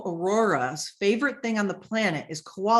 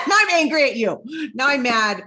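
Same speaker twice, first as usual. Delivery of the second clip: louder and higher, laughing through pretend anger; laughing mock anger; 4 semitones higher, 7 dB louder than usual. Annoyed at a sales bot that won't stop, laughing mock anger. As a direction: play at being mad while cracking up.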